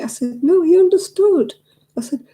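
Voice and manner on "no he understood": much higher and louder, firmly overruling; emphatic retort. Retold or imitated. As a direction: insist on "No, he understood" to her mum. Arguing back to her mum.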